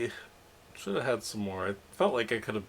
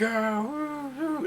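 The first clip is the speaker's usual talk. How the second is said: high mock-crowd whooping; imitated high cheers; crowd cheering; slower than usual. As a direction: whoop like distant fans. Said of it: "there's definitely people now cheering".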